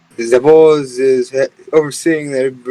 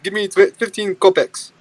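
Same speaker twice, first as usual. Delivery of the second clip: higher-pitched mock Russian accent; 6 semitones higher than usual. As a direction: play a grumbling Russian hiker, lightly. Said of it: "we have to do a Russian voice".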